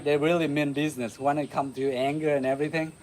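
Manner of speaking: ordinary talk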